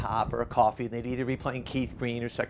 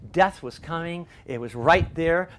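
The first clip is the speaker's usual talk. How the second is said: normal talking voice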